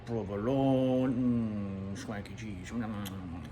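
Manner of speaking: slow, drawn-out relish over each food; trailing into a low, soft mmm; savoring. Pretend. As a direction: linger on it as if tasting it. Savoring a list of sandwich foods.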